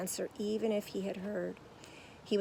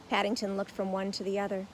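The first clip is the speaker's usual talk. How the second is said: plain reading voice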